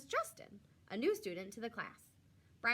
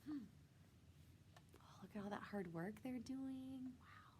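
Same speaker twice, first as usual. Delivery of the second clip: whispered aside; 3 semitones higher, 13 dB quieter than usual. Real, not acted. Reader's aside over pictures.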